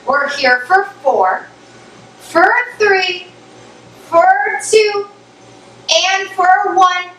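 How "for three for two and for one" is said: upbeat countdown. Real, not acted. Instructor counts down final reps.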